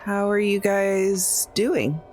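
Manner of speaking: ordinary talk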